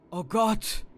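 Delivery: sudden high cry of shock; loud, high "Oh Gott!" of horror; horrified gasp. A narrator's reading. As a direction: blurt it out in terror. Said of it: "entfuhr es mir".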